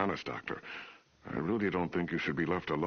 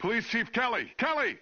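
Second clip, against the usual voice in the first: urgent shouts for the chief; calling out; 4 dB louder than usual. Acted. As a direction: yell for help across the room. Calling out for help.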